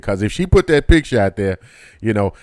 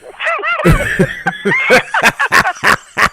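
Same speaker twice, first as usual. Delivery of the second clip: big high laugh. Real, not acted. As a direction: crack up high and long. Host cracks up after joke.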